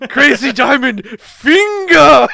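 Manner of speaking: shouted attack name. Pretend.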